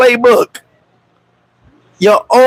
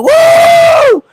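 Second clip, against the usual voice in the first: high, loud mock cheer; party whoop; 5 dB louder than usual. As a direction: whoop like at the club.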